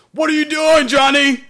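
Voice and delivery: high, loud shrieking scold; hammed-up teacher yelling at a pupil; shrill pretend telling-off of Johnny; mock angry teacher. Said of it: "what kind of teacher would I be if I got angry".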